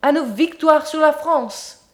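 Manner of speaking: slow, swaggering shouted toast; drunken shout. A narrator's reading. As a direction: bellow the toast like a drunk patriot. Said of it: "il cria".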